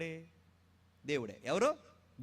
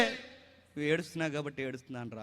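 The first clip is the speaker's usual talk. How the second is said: soft, much quieter, gentle answer; tender God's reply; 3 semitones lower, 4 dB quieter than usual. Retold or imitated. God answering his crying child, soft and tender. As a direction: answer like a grieving father. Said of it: "అంటాడు".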